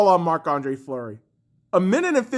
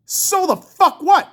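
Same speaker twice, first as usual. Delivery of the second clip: high, slow, punched words; escalating angry dismissal; 8 semitones higher, slower than usual. Real, not acted. Fan rejecting excuses for the goalie, escalating angry dismissal. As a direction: raise the pitch and spit out each word slowly.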